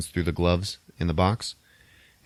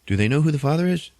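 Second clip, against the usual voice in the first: quick, high teasing jab; mock snarky crewmate; 9 semitones higher, 3 dB louder, faster than usual. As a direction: fire off the joke like a wisecracking crewmate. Crewmate's joke, retold.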